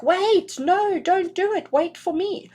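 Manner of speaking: high, loud desperate plea; pleading third wheel. Retold or imitated. Reader voicing the left-out man on the card, high loud plea. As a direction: call out after the couple not to leave him behind. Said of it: "He's like".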